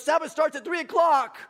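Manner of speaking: loud, high mock insistence; retold yelling. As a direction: shout down the objection, imitating a scold. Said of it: "he's just yelling at me".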